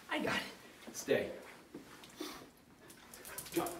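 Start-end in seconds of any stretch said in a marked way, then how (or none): 0.1-1.0 whispering. Real, not acted. Whispered aside.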